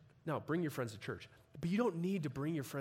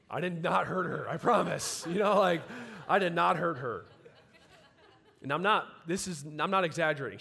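louder, half-laughing protest that he did nothing; laughing loud insistence; 5 semitones higher, 8 dB louder than usual. Real, not acted. To the congregation, laughing that he did not hurt her.

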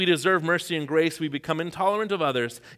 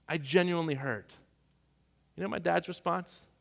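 usual speaking voice

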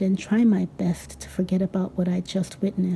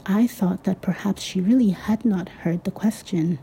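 usual speaking voice

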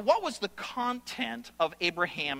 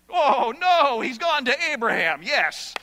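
mock dismay; 5 semitones higher, 7 dB louder than usual.

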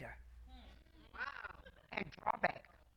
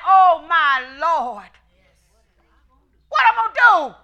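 mock panic; 2 semitones higher, 10 dB louder, slower than usual. Acted panicked believer.

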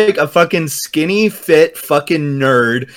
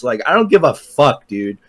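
normal talking voice

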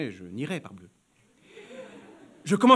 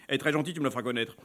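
quick low man; 6 semitones lower, 5 dB quieter, much faster than usual. Acted. Client's voice.

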